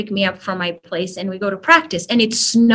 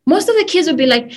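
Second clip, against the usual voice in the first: ordinary talk, nothing marked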